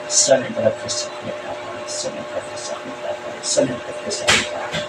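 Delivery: low breathy whisper, repeated like a spell; whispered incantation. Acted. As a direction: whisper the words over and over. (pabulong na orasyon)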